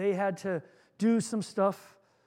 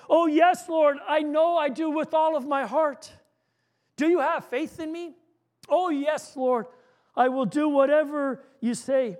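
higher and louder, eager to please; eager assurance; 6 semitones higher, 6 dB louder than usual. Acted. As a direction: promise everything to the Lord. George eagerly assures the Lord.

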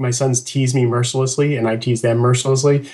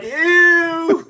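grossed-out ew; 3 dB louder than usual. Retold or imitated. Both sons echo the reaction.

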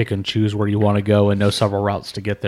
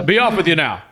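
lightly acted guard, pitched up; dismissive guard; 7 semitones higher, slower than usual. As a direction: send them packing in mock officiousness. Guard loudly dismissing the travellers.

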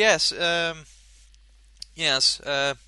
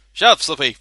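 annoyed snap; 11 dB louder, faster than usual. Pretend. Snapping at character.

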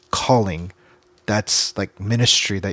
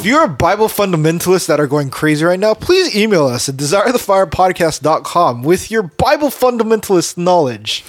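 raised, loud, mock-formal call-out; mock announcer; 6 semitones higher, 5 dB louder than usual. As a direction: ham it up like a podcast ad. Mock-formal appeal to fundamentalists.

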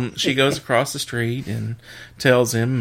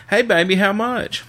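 higher-pitched mock pickup line; imitated come-on; 8 semitones higher than usual. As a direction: lightly play a customer calling out a proposition. Driver propositions wife in joke.

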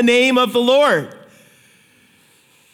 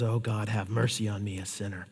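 very soft, fading plea for mercy; humble quiet prayer; 11 semitones lower, 13 dB quieter than usual. Acted. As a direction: pray it barely aloud, head bowed. Humble tax collector's prayer.

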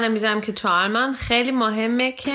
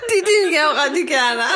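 laughing; 7 semitones higher, 4 dB louder than usual. Real, not acted. Laughs at own language mix-up.

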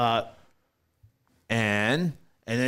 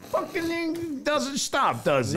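much higher, slow, whiny protest; exasperated; 12 semitones higher, slower than usual. Real, not acted. Host complaining the cohost won't stop jabbing, high whiny exasperation. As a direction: moan about being cut off.

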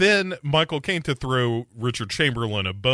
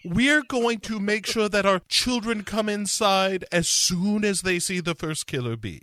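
lightly high principal's voice; prim school principal voice; high woman voice; 8 semitones higher than usual. Acted. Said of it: "I'm Maureen. I'm the principal".

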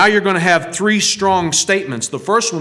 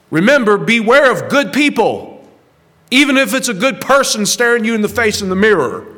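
rising sermon fervour; fervent preaching; 3 semitones higher than usual. Acted. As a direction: pitch raised, pressing the warning home. Preaching climax, raised voice.

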